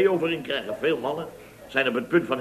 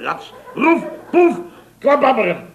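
rising, louder comic stab sounds; stabbing noises; 7 semitones higher, 4 dB louder than usual. Retold or imitated. Rang acting out the knife, hammed-up stab sounds. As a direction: spit out the cartoon stabbing noises, climbing up.

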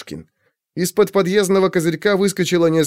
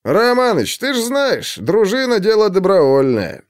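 amused smirk; 7 semitones higher than usual. A narrator's reading. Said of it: "усмехнулся милиционер".

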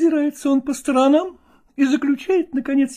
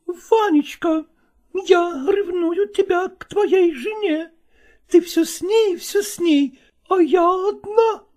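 mistress voice; 6 semitones higher, slower than usual. Acted.

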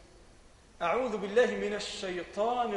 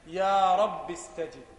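slow, louder, pleading يا رب استجب; emphatic plea; 6 dB louder, slower than usual. Shown as a demonstration. A teacher explaining to students what amin means, emphatic plea. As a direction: lean into يا رب استجب, each word slow and full. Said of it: "آمين يعني".

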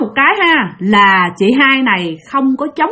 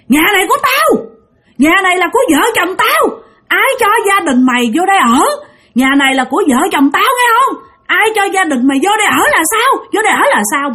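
much higher, strange possessed voice shouting; possessed ghost yelling; 9 semitones higher, faster than usual. Retold or imitated. Dead husband's spirit through Phụng yells at husband, high hostile shout. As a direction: rage like a ghost claiming its house. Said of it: "vừa la mà vừa quậy phá".

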